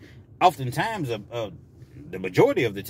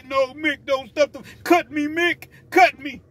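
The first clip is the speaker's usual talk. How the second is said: much higher, strained boxer imitation; Rocky; 12 semitones higher, 4 dB louder, slower than usual. Boxer begging his trainer, hammed-up plea. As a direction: plead desperately with your trainer, hammed-up. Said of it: "And Rocky".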